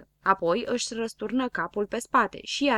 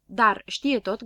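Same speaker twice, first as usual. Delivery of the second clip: plain reading voice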